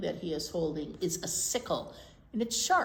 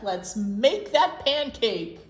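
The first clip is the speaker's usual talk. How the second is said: slow, loud drawn-out plea; slow insistent; 3 semitones higher, 10 dB louder, slower than usual. Acted. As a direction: stretch out each word. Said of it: "shouted Jack".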